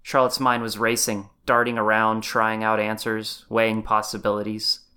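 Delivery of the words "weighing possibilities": plain reading voice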